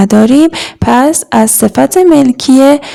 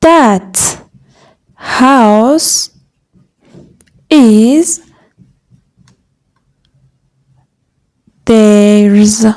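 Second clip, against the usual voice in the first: each word separated by long pauses; slow dictation; much slower than usual. Shown as a demonstration. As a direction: dictate it one word at a time. Teacher dictating a translation.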